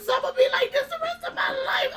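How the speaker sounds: high panicked cry, half laughing through it; telling the doctors in a high panicked outburst; flipping out. Retold or imitated. Patient panicking at lupus news, high laughing cry. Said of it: "I'm flipping".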